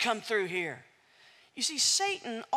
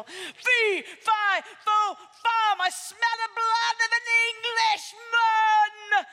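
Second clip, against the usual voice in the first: high, loud bellowing giant chant; roaring giant; 10 semitones higher, 7 dB louder than usual. Acted. Giant sniffing out Jack, a loud roaring bellow. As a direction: roar it through the castle. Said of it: "giant's chant redone".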